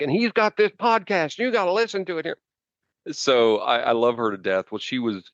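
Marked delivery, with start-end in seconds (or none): 0.0-2.4 imitating a keen friend in a much higher pitch; high-pitched, lightly mocked pitch for a show; eager friend. Friend plugging the podcast to somebody new, eager imitation. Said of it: "she's like".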